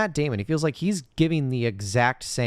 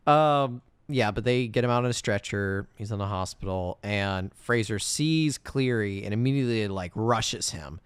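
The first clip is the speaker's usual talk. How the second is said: ordinary talk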